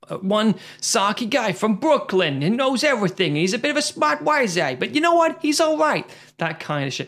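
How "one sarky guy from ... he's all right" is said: high, hammed-up sales patter; mock movie pitch. Host parodies writer listing squad clichés, mock-enthusiastic. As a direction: climb higher as you sell the stock character.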